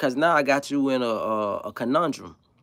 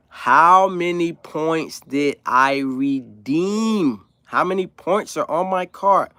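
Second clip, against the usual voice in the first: very slow, louder, every word drawn out; over-slow enunciation; 3 semitones higher, 5 dB louder, much slower than usual. Retold or imitated. To the cashier, exaggerated slow mock enunciation. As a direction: talk like she can't understand a word. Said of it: "Now I'm talking like, it's like sign language".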